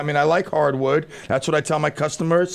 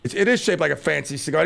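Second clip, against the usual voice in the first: normal talking voice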